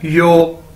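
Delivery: "यो" like yo in "yo man"; careful teacher's "यो"; demonstrated pronunciation. Shown as a demonstration. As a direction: model "यो" as one syllable. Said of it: "कैसे बोलते हैं".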